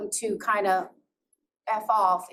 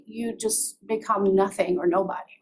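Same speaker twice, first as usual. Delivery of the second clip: ordinary delivery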